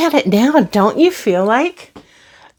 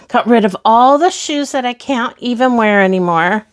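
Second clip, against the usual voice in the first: ordinary talk, nothing marked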